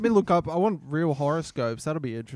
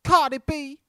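high, punchy put-on rapper accent; hammed-up imitation of an American rapper's accent; 11 semitones higher, 4 dB louder than usual. Asked by co-host for her accent, playful high imitation of the rapper's accent. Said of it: "do that in her accent".

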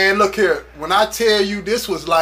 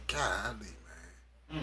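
much softer, low and stunned; hushed dismay; 13 semitones lower, 21 dB quieter, much slower than usual. Retold or imitated. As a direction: let it fall out under your breath. Hearing the friend is shot.

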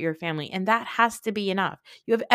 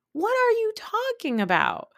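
very high squeal of disbelief; utterly incredulous; 13 semitones higher than usual. Acted. Repeats incredulous question, escalating.

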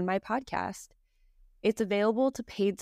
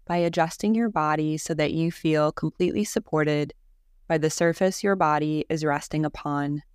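guided meditation; 3 semitones lower, 6 dB louder than usual. Real, not acted. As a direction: guide them calmly through each breath, unhurried. Leads a guided Yoga Nidra.

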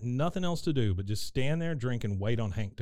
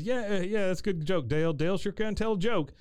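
embarrassed Hank; 7 semitones higher than usual. Retold or imitated. He says.

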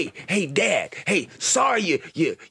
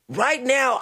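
normal talking voice

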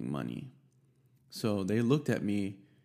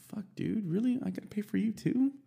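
exasperated disbelief; 11 semitones higher than usual. Retold or imitated. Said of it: "And I'm like".

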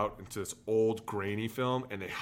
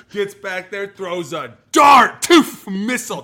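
loud, high hype; excited; 11 semitones higher, 17 dB louder, slower than usual. Retold or imitated. Telling the host about the coach's throw, loudly excited. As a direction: sell the throw like a highlight.

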